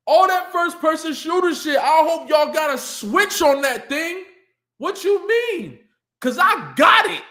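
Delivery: fired up by the exchange; hyped shout. Real, not acted. Hyping up a rapper's comeback, shouting.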